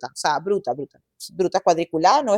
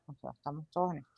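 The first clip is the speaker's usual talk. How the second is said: very soft, low, quick aside; private thought; 6 semitones lower, 14 dB quieter, faster than usual. Retold or imitated. Podcast guest recalling what she thought as others discussed sex, hushed private thought. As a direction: murmur it like a thought kept to yourself. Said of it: "y yo decía".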